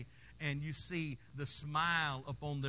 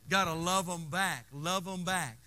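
usual speaking voice

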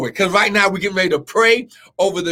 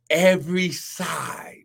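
whispered; 2 semitones lower, 5 dB quieter, slower than usual. Real, not acted. Preacher finishing the verse to the congregation, whispered. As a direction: drop to a slow whisper. Scripture quote ends whispered.

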